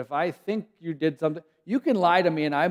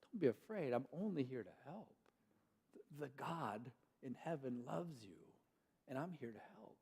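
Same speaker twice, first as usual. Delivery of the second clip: much softer, gentle reassurance; reassuring angel; 3 semitones lower, 21 dB quieter than usual. Retold or imitated. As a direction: comfort the frightened human. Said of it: "the angel says".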